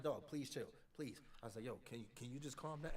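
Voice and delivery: mildly subdued request to chill; understated calming appeal; restrained pleading. Retold or imitated. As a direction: plead while holding yourself back. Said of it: "I'm trying my hardest".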